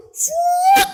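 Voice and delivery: very high, loud zipping noise; imitated whoosh. Speaker imitating angels rushing off, high whoosh. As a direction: whistle past like something darting away.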